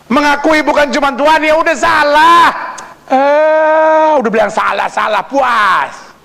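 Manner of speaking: high, loud pulpit scoffing; mocking a glib confession. Mocks a careless confession of sin. (mencemooh pengakuan asal-asalan dengan nada tinggi)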